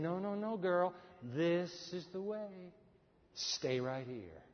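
steer her quietly, word by word; gentle whisperer voice. Retold or imitated. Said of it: "the voice of the whisperer who keeps saying".

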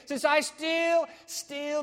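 "still" held long, high and repeated; stress on STILL. Acted. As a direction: lean hard on "still", slow and high-pitched. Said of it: "the emphasis on the word still".

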